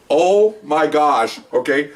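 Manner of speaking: normal talking voice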